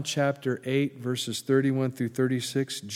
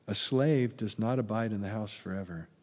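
usual speaking voice

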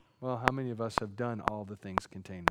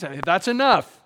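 loud, raised parental rebuke; exasperated scolding; 6 semitones higher, 10 dB louder than usual. Acted. God stops a believer arguing, loud parental scolding. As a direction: shut the arguing down loudly.

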